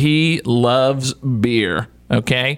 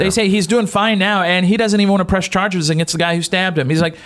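ordinary delivery

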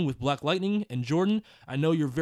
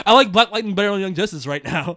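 loud; 3 semitones higher, 9 dB louder than usual. Real, not acted. Loud laughing claim.

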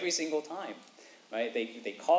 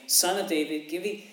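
higher, louder plea; pleading cry; 5 semitones higher, 5 dB louder than usual. Retold or imitated. Bartimaeus begging Jesus for undeserved mercy, raised plea. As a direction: beg for what you can't earn. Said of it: "another way of saying".

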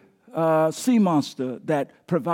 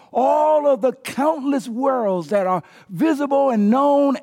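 rising, louder sermon climax; booming preacher voice; preaching at full force; 7 semitones higher, 4 dB louder than usual. Acted. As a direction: build the praise higher and higher.

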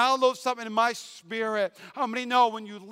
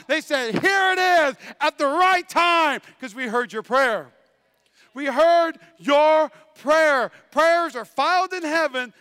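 high, loud preaching climax; shouting the promise over the congregation; full-force preaching; 6 semitones higher, 9 dB louder than usual. Acted. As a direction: preach it to the rafters.

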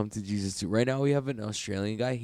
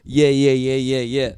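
loud, higher rapid-repeat mock yelp; imitating visitor; 4 semitones higher, 12 dB louder, slower than usual. Mimicking visitor who won't say tap, loud mock urgency. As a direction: blurt it repeatedly as a caricatured submission. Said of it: "or he went".

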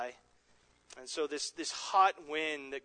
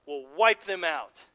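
ordinary talk

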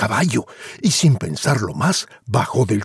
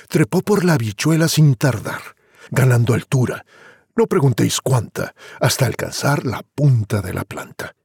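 ordinary delivery

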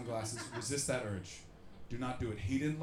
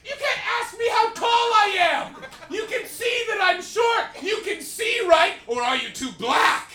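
mock furious shout; 14 dB louder, slower than usual. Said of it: "And he went".

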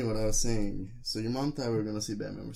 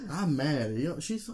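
ordinary delivery

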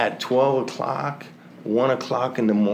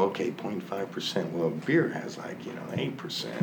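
softer, half to himself, working out numbers; muttered calculation; 4 semitones lower, 10 dB quieter than usual. Retold or imitated. Drinker reasoning with himself over the label, softly. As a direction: talk yourself into it quietly.

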